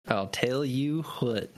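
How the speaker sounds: put-on slow, drawn-out southern accent; hammed-up slow southern drawl. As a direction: stretch out the southern twang. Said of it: "give the kid a southern accent".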